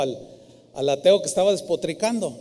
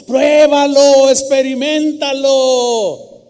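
slow, much louder shout; shouted exhortation; 6 semitones higher, 9 dB louder, much slower than usual. Acted. The old woman's punchline to the atheist, shouted slow and loud. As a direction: drive the challenge home at full voice.

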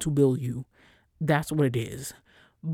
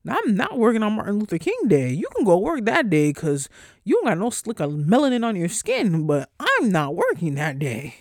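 louder, firm and sassy refusal; firm retold refusal; 5 semitones higher, 5 dB louder than usual. Telling a coworker she won't work on MLK Day, firm and loud. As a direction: lay down the law to her. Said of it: "I said, Ma'am".

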